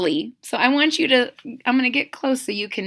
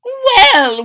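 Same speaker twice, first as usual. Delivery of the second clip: very high, loud mother; an eager mama wanting news; Mama's voice; 14 semitones higher, 10 dB louder than usual. Acted. Mama asks her son about school, very high eager.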